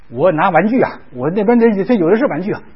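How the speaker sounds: ordinary talk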